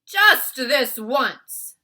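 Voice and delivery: very loud, very slow booming giant; the giant agreeing to try the vegetable; grudging Bloodbottler. A narrator's reading. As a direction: drag it out, reluctantly giving in. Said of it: "the blood bottler said".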